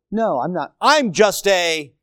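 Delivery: ordinary talk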